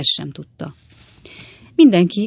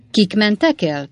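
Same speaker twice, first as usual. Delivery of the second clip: sharp, higher-pitched question; éles hang; 5 semitones higher than usual. A narrator's reading. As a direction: demand it sharply. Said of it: "kérdezte Wardle úr éles hangon". (éles hangú számonkérés)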